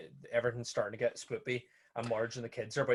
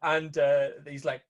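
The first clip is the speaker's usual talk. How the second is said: normal talking voice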